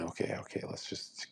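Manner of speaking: unvoiced whisper, backing off; hushed aside. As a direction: whisper it like you got caught. Mock aside about ID.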